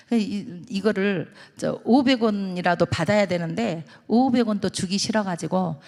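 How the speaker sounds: normal talking voice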